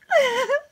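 high squealing giggle; teasing giggle. Acted. Maid teases Emily with a high giggle. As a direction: giggle to tease her mistress.

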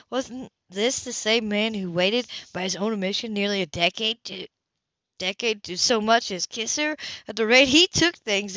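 ordinary delivery

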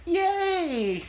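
high, drawn-out happy cheer. Real, not acted. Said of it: "you're such a little kid".

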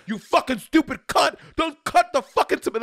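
high, loud mock chef tirade; Gordon Ramsay rant. Mocking Gordon Ramsay yelling at a cook, loud and high. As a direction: bark insults like a furious TV chef. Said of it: "He is".

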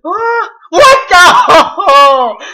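loud frightened yelling; panicked screaming. Real, not acted. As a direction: scream and flee the ghost. Ghost catches him, screaming.

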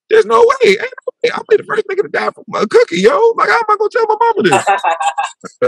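very high, comic wailing; mock despair. Man sure a cookie will kill him, high mock-despairing wail. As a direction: wail it in a squeaky falsetto. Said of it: "went through all nine stages of depression".

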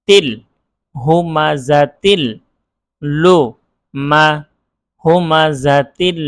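HU, MA, then HUMA, built up piece by piece; measured recitation drill with pauses between syllables; each letter separately. Shown as a demonstration. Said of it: "প্রত্যেকটা হরফের আলাদা আলাদা উচ্চারণ".

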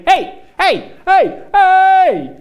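loud, high repeated hey shouts; shouting again. Retold or imitated. Stranger keeps calling the cyclist, loud high shouts. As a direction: bellow hey after someone riding away. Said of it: "ik hoor vrijwel meteen".

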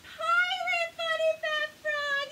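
high, loud grand introduction; excited reveal of Pirate Funny Fat Frog; announcing. Shown as a demonstration. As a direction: present the pirate frog with a flourish. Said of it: "Who is this?".

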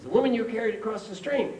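high, loud exasperated reply; acted indignant outburst; angry monk. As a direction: spell it out in mock outrage. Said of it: "The angry monk says".

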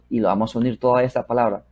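normal talking voice

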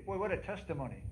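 usual speaking voice

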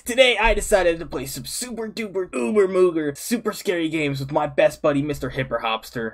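loud, high, hammed-up hype shouting; hyped-up intro. Streamer hyping viewers to hit like, hyped shouting. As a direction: belt it out like an over-the-top video opener.

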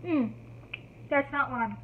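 talking while chewing jelly; swallowing between words; mouth full of jelly. Real, not acted. Seen 0: Just slurped jelly cup; lips pressed, chewing, then talks holding cup.

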